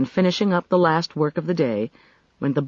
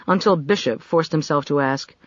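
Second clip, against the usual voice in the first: ordinary delivery, nothing marked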